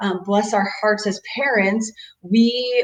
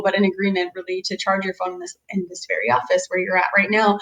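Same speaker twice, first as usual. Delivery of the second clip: ordinary talk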